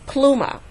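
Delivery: "pluma" stressed on PLU, falling on "ma"; stress on first syllable. Shown as a demonstration. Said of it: "the stress would fall on the plu".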